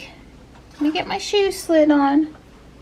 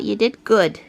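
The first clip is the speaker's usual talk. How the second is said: lower, murmured praise for the cat; 7 semitones lower than usual. Real, not acted.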